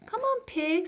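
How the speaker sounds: put-on high eager girl voice; Florentine's voice. A narrator's reading. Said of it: "said Florentine".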